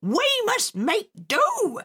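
put-on high, slow sharp retort from the old pirate; sharp pirate mother. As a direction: snap it back, slow and high. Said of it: "his mother answered sharply".

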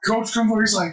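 usual speaking voice

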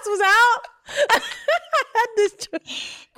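high, laughing out the words; laughing hard. Real, not acted. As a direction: crack up at yourself.

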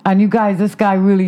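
ordinary talk

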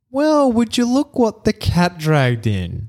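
high, slow, theatrical welcome; mock greeting voice. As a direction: ham up the old-friend greeting. Greeting the returning co-host.

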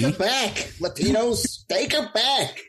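mock plea. Mocking call to Latinos.

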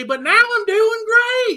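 high, slow mock Southern drawl; changed Southern accent. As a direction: switch into a stretched-out fake Southern accent. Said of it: "would change my accent".

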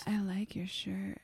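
breathy whispered come-on; failed seductive.

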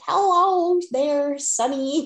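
quavering sweet granny asking for help; old lady voice. Pretend. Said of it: "used an old lady voice and be like".

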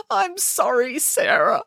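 high-pitched tearful apology; sobbing. A narrator's reading. Boris apologising to his nanny, sobbing. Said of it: "sobbed Boris".

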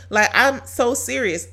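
ordinary delivery

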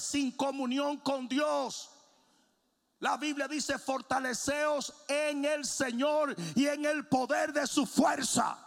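loud, slow, soaring sermon; preaching at full force. Acted. Pastor urges the church to seek God, loud slow preaching. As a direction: preach it out, building to a cry.

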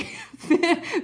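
short high laugh at her own joke; burst of laughter. Real, not acted. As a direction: crack up at yourself.

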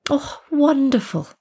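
high, lilting polite delight. A narrator's reading. Tea lady hearing they are students, polite. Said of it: "Wendy said politely".

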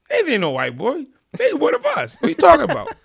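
very high, loud disbelief; incredulous. Retold or imitated. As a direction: protest as if it's absurd. Retells his disbelieving reply.

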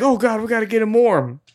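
high, urgent retold cry; mock panic. Retelling the rush to warm Santa, high mock panic.

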